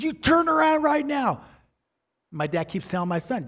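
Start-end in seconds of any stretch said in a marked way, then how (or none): 0.0-1.4 high, loud furious yell over the fence; angry yell. Retold or imitated. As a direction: bellow it like an enraged neighbour shaking the fence. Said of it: "the neighbor's yelling this whole time".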